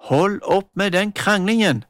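mother calling from the doorway, stop them. Acted. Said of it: "ropte mamma".

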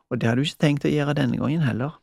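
plain reading voice

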